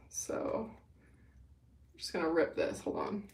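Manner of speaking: real focused whisper. Real, not acted. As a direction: whisper under her breath while working. Concentrating while ripping the hair.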